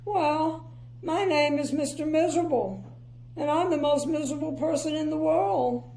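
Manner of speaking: high, sniffy and glum; sniffy miserable voice. Acted. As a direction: sniff it out disdainfully. Mister Miserable speaks.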